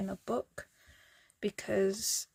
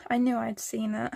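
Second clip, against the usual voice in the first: usual speaking voice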